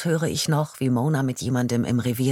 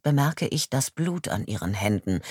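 ordinary delivery, nothing marked

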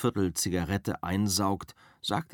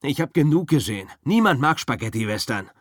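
loud, fast and firm; firmly; 6 semitones higher, 7 dB louder, faster than usual. Acted. Rick insists nobody likes spaghetti westerns, loud and firm. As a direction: shut the topic down firmly. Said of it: "Rick sagt bestimmt".

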